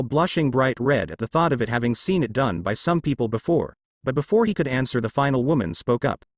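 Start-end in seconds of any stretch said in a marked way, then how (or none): none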